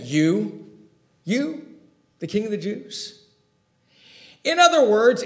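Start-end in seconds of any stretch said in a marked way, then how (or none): 0.0-3.2 incredulous You. Shown as a demonstration. Pilate mocks Jesus with incredulous stress on You. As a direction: scoff at You, you as if it's absurd. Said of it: "He's saying".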